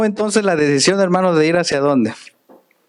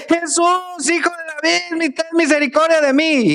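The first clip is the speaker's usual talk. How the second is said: much higher pleading cry; 10 semitones higher than usual. Acted. Blind beggar crying to Jesus, high pleading call. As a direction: beg Jesus, voice lifted high. Bartimeo cries out again.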